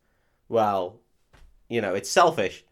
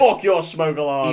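loud, higher-pitched outburst; mock angry shout; 5 semitones higher, 6 dB louder than usual. Co-host playing the man kicking off, mock shouting. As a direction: yell it like a hammed-up tantrum.

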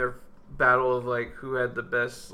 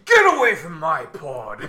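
loud mock imitation, higher and louder; mimicking Thor; 6 semitones higher, 6 dB louder than usual. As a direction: ham up a pompous voice. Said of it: "when he starts mimicking Thor".

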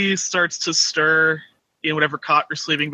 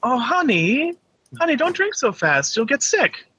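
put-on worried girlfriend; 8 semitones higher than usual. Girlfriend fretting over drinking.

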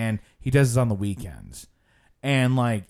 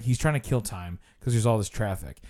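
usual speaking voice

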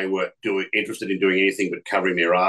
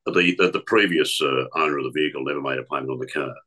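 credit company caller; 8 semitones lower than usual. Retold or imitated. Prank caller to young woman, stiff official collector voice. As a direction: lower put-on official debt collector voice. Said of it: "I phoned her from the credit company".

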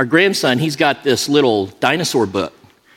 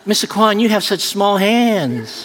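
mock pained protest; 7 semitones higher than usual. Retold protest to masseur during massage.

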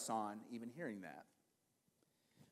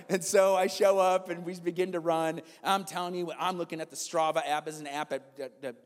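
ordinary talk, nothing marked

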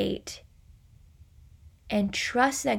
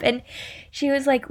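normal talking voice

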